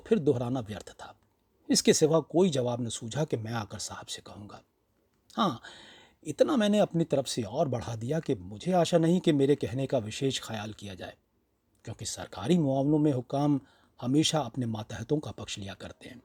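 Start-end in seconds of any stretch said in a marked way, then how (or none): none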